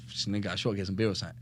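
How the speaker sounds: retold quiet, low-pitched excuse; low-key excuse. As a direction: say it softly, playing it down. Covering to brother, hiding the news.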